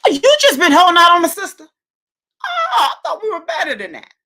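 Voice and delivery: loud, high playful outrage; hammed-up offended protest; mock indignation. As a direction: shriek the accusation in pretend hurt. Teasing friend for withholding news.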